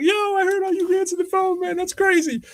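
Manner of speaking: very high-pitched, put-on teasing voice; mock laughing friend. Friends calling to tease him about his phone manner, mock-amused high voice. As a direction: imitate a buddy cracking up in a squeaky high voice. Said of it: "friends would call up and be laughing".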